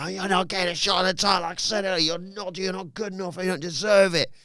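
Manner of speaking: high, rapid mock protest; indignant refusal. Champion turning down a title challenger, acted indignation.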